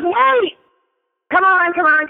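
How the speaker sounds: high-pitched imitated cry to wait; acted urgent hurry-up; urgent calling out. Acting out woman halting the door.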